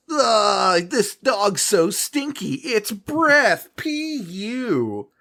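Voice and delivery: high, squeaky disgusted voice; disgusted guard. Retold or imitated. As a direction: whine about the stinky dog. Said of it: "this guy is just like".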